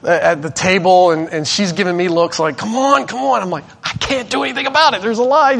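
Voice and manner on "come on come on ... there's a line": frustrated. Retold or imitated. Retold frustrated exchange with wife.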